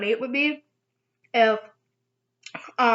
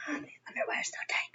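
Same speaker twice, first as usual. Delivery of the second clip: quick whispered complaint; whispering; 4 semitones higher, 14 dB quieter, much faster than usual. Real, not acted. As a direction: hiss it fast under your breath.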